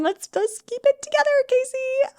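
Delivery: high teary voice talking herself down; steady yourself as tears come; self pep talk through tears. Real, not acted. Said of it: "try not to cry".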